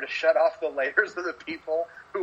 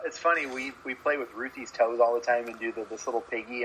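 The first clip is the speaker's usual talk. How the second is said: ordinary talk, nothing marked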